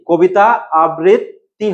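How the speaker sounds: আবৃত্তি drawn out slowly with a held double t; slow, split-up আবৃত্তি; slow model pronunciation. Shown as a demonstration. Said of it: "তাহলে দেখো আমি কি বললাম".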